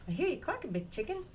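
high, cheeky chick voice; little chicken voice. A narrator's reading. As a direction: chirp it back at Mama, playful. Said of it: "Little chicken says".

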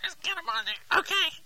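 nose clipped with a clothespin; pinching nose. Real, not acted. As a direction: talk through a pinched nose. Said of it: "put this clothespin on your nose".

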